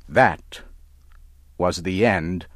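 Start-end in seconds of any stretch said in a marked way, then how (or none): none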